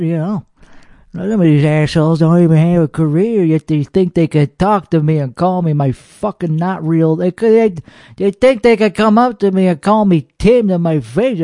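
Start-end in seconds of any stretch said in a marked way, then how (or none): none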